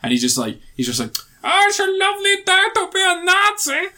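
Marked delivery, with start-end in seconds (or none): 1.4-3.9 high, hammed-up German accent as a Nazi officer; mock German accent. Mocking a video game Nazi villain, silly high German accent. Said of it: "That's my German accent".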